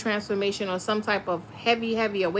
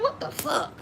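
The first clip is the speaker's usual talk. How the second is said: much higher, breathy whispered disbelief; startled hushed swear after a long nap; shocked whisper; 12 semitones higher, 5 dB quieter than usual. Retold or imitated. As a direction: gasp it out under your breath, stunned. Waking to find an hour gone.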